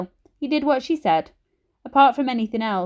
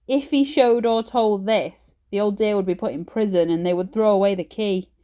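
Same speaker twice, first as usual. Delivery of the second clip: usual speaking voice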